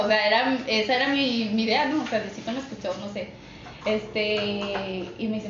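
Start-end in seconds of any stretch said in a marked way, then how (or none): none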